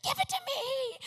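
be the whole room begging for a disc; grabby crowd. Retold or imitated. Conference crowd begging the speaker, high eager cries. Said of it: "everybody jumps up".

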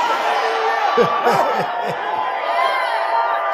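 high, breathy whooping laugh in the pulpit; preacher's ecstatic laughter. Real, not acted. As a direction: let out a joyful holy laugh. Ecstatic laugh mid-sermon.

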